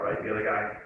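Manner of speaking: usual speaking voice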